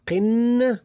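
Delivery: "Qin" with long n; held syllable. Shown as a demonstration. Teacher counting syllables, "Qin" held on the n. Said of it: "So we have three syllables here".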